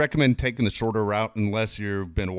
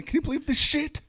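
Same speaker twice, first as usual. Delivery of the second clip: mocking angry son. Son scolding his mom over the photos, high sarcastic voice. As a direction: sneer at your mom's useless photos.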